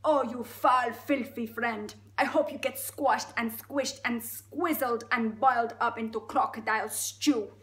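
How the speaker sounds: put-on slow, loud, outraged Russian accent; Russian elephant. Elephant cursing the crocodile, outraged Russian. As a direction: denounce with heavy Russian emphasis. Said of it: "It's gone full Russian now".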